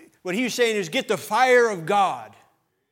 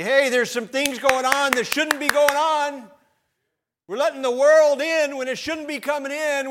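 higher, scolding sermon voice; sharp reproof; 6 semitones higher than usual. Retold or imitated. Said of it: "the job of the preacher is to reprove".

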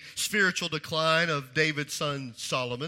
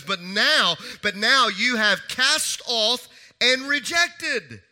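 louder, slow and drawn out, higher; building preaching cry; 5 semitones higher, 7 dB louder, slower than usual. Acted. Preacher voicing the psalmist's complaint, loud and slow. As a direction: cry the words out to God slowly. Preacher repeats the quote with force.

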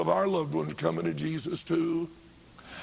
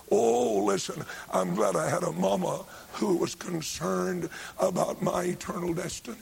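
ordinary talk, nothing marked

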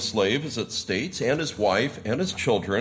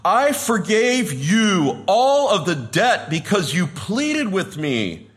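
high, loud, slow rebuke; the king condemning the wicked slave, slow and loud; wrathful king; 6 semitones higher, 4 dB louder, slower than usual. Retold or imitated. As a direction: thunder the king's judgment down on him. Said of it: "his lord said to him".

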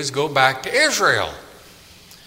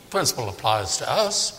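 usual speaking voice

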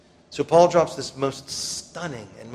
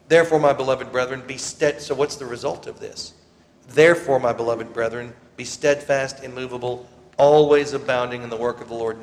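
loud, ringing scripture reading; preaching scripture; 7 dB louder than usual. Acted. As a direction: proclaim it to the whole church. Reads scripture exhortation.

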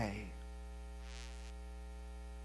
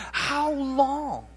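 high anguished cry, held back in volume; with despondency; 6 semitones higher than usual. Shown as a demonstration. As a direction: ache through the question. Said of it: "read it with passion, with despondency".

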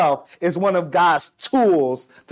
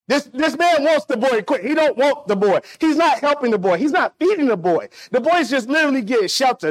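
higher-pitched, insistent sermon delivery; impassioned preaching; 5 semitones higher than usual. Real, not acted. Preacher explaining the prodigal's servitude, urgent and raised in pitch. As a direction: drive the point home to the congregation.

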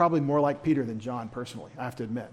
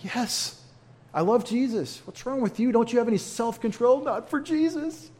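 high, put-on voice, pushed up to the end; exasperated reply; 8 semitones higher than usual. Calm one hitting back at Peter, high and exasperated. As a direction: fire the question right back at him. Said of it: "And you're like".

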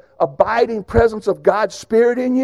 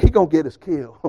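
laughing aside; 4 semitones lower than usual. Retold or imitated. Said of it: "And Chad was like".

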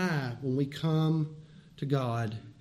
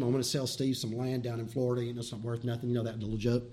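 ordinary delivery

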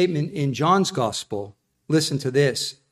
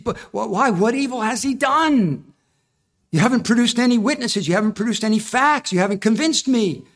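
higher, fast, exasperated questioning; protesting Pilate; 6 semitones higher than usual. Retold or imitated. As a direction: Pilate argues with the crowd for Jesus, exasperated.

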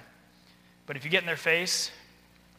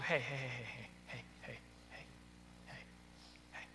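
repeated heys fading from soft to barely there; almost whispering; 4 semitones lower, 17 dB quieter than usual. Shown as a demonstration. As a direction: bring the volume down step by step. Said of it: "To where you're almost whispering".

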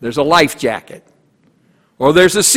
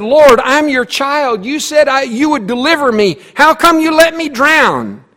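pleading complaint; 9 semitones higher, 3 dB louder than usual. Acted. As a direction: whine it up at God. Drowned man complaining to God.

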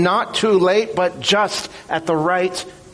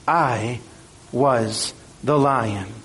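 solemn lion's reveal; 5 semitones lower, slower than usual. Acted. Aslan reveals himself, solemn.

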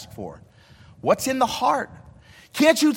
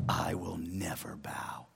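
whispered prayer; 9 semitones lower, 11 dB quieter, slower than usual. Acted. Voicing a surrendered prayer.

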